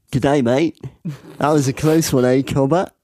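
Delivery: mock Australian with lifted line endings; Australian accent. Tall gaunt man remarks to the player on a close shave, Australian accent. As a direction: put on a matey Australian twang. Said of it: "From Australia, from New South Wales".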